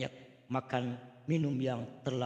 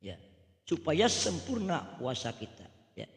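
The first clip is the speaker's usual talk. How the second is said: ordinary delivery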